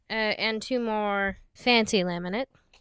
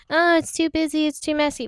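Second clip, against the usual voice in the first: higher-pitched imitation of fussy critics; mock complainer; 6 semitones higher, 4 dB louder than usual. Crafter imitating people who dislike holographic laminates, mock complaint. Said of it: "other people are gonna be like".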